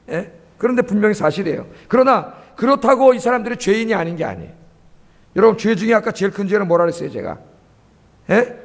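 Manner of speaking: normal talking voice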